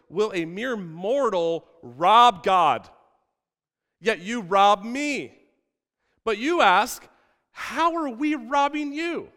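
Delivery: slow, high, ringing scripture delivery; dramatic preaching. Acted. Preacher voices God's challenge to the people, dramatic preaching. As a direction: proclaim it forcefully, stretching the words.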